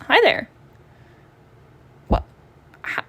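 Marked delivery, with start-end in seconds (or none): none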